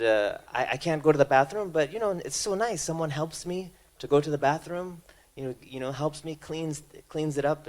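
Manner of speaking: lightly voiced happy old man; cheerful grateful patient. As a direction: voice a content patient, upbeat.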